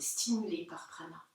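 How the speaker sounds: ordinary talk, nothing marked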